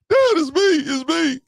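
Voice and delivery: put-on terrified kid crying out to his dad in the dark garage; panicked son. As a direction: yell it fast so your dad knows it's you before he swings. Son caught sneaking in, nearly chopped.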